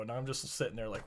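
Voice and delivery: ordinary talk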